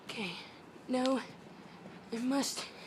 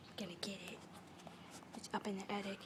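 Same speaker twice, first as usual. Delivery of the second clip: hushed unvoiced whisper; 8 semitones lower, 17 dB quieter, much faster than usual. Real, not acted. Whispering while sneaking up on the bird. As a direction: whisper so the bird won't hear.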